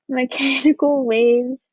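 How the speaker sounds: a real sheepish laugh on Mechanical; embarrassed laugh. Real, not acted. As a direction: giggle at your own typo while saying Mechanical. Laughs at own slide mistake.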